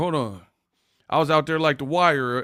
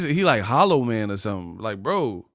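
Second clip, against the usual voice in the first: ordinary talk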